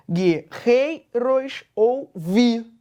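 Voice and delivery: "guerreiros ouvi" very slowly, stressing "rei" and "vi"; "guerreiros ouvi" in separate beats, strong on "rei" and "vi"; weak-strong scansion. Shown as a demonstration. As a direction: split "guerreiros ouvi" by syllable and lean on "rei" and "vi". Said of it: "parece que as tônicas são o rei".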